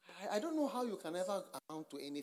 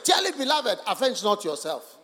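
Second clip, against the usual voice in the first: loud, ringing recitation of Romans; preacher thundering Romans twelve; loud scripture proclamation; 3 semitones higher, 11 dB louder than usual. Real, not acted. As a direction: proclaim the verse to the hall. Reading scripture loudly.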